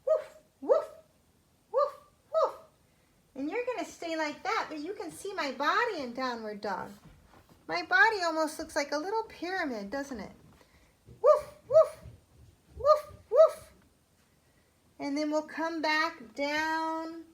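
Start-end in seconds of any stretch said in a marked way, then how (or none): 0.1-2.6 high, loud playful woofs; yappy little dog woofs; puppy barking. Shown as a demonstration. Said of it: "some barking". 11.2-13.7 very high, loud yappy woofs; squeaky loud puppy barks; puppy barking. Shown as a demonstration. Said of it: "some barking".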